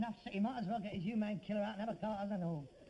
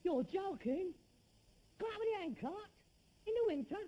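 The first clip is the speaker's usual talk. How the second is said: pitched up high in disbelief; incredulous; 9 semitones higher than usual. Real, not acted. Son refusing to push the handcart in winter, incredulous. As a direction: balk at the idea, voice shooting up.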